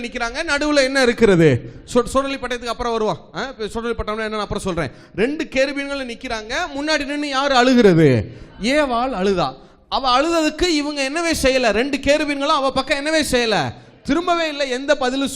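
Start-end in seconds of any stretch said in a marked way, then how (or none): none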